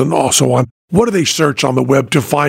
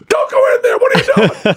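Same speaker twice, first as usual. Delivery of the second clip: moviegoer's heckle, only lightly raised; mock yell; 4 semitones higher than usual. Host imitating people yelling at a movie screen, mock yell. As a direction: yell at the screen, half in jest. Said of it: "you would have people yell".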